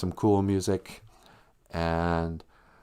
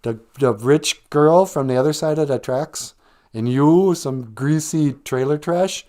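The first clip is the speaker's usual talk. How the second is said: dramatic princess; 5 semitones higher, 6 dB louder than usual. Retold or imitated. Princess swooning over Bender, hammed-up drama. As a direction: gush like a melodramatic heroine. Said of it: "she's like".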